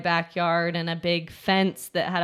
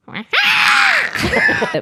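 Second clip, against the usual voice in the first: shrieking laugh; 6 dB louder than usual. Real, not acted. Burst of laughter at chickens.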